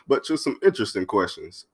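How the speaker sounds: ordinary delivery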